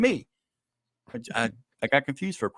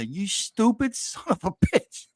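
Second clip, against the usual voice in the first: mock self-scolding; 7 semitones higher, slower than usual. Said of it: "and I'll say".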